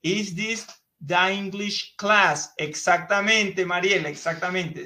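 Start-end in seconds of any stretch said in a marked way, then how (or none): 0.0-2.5 slow, careful English question; slow model question. Shown as a demonstration. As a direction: spell it out slowly for the class.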